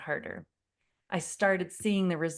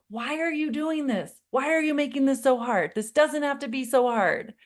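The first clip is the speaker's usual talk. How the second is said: high, louder pleading panic; panicked; 7 semitones higher, 4 dB louder than usual. Retold or imitated. Mom pleading with clinging son, high panicked. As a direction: beg him in exasperated panic. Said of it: "I just keep getting more panicked".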